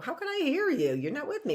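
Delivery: usual speaking voice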